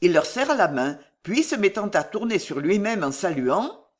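ordinary delivery, nothing marked

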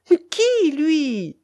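high, loud burst of impatience; insistent demand. A narrator's reading. The baron presses Rochaine for the name, insistent. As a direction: demand the answer at full pitch.

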